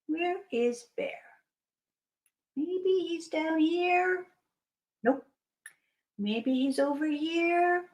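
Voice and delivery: soft, slow, wondering aloud; hushed searching. Acted. Hippo hunts for hidden Bear, hushed and slow. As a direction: search softly, stretching each guess.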